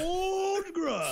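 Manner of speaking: high, hammed-up wail; mock wailing. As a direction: wail the name like a lament. Mock lament over the name.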